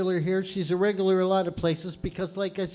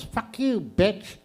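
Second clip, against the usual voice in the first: higher-pitched cursing parrot; 3 semitones higher, much slower than usual. Acted. Parrot cursing at the woman who bought it, squawky parrot voice. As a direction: swear like a cheeky parrot. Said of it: "The parrot goes".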